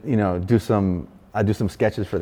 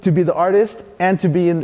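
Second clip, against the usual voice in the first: ordinary talk, nothing marked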